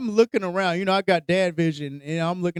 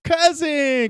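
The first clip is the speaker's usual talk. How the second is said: high, loud drawn-out shout; mock zombie-scene cry; 10 semitones higher, 6 dB louder than usual. Zombies come in on those hiding, loud mock cry. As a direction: call out high and loud, hammed-up. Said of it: "the zombies come in, they're like".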